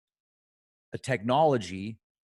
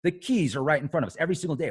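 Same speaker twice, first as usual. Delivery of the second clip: usual speaking voice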